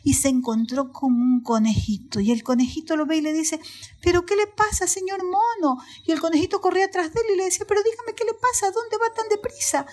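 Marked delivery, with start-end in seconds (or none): 4.0-5.8 high-pitched rabbit's question; alarmed little rabbit. Acted. Voices the startled rabbit. 7.7-9.9 high, quick rabbit questions; anxious little rabbit. Acted. Rabbit running after the monkey.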